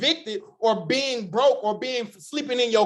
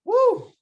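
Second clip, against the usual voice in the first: excited cheer; 5 dB louder than usual. Real, not acted. Cheering after the testimony.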